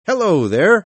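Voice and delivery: loud, high call across the room; shouted greeting. A narrator's reading. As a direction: call out to a late guest. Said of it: "I shouted".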